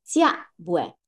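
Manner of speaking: 驾不哎 spoken in Teochew; Teochew dialect. Shown as a demonstration. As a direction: repeat 驾不哎 in plain Teochew tones. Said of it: "吃了吗？是怎么说来着".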